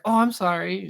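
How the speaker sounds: high-pitched imitation of a pushover; a little high, teasing sorry; mock meek apology. Imitating co-host apologising to the seller, mock meek.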